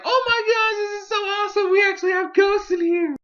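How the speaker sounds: very high, excited little squeal; Bonnie. Acted. Girl thrilled that the house really has ghosts, high excited squeal. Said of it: "Bonnie's just like".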